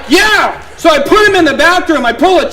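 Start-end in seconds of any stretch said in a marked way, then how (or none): 0.1-0.6 shouted yeah. Retold or imitated. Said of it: "I'm like".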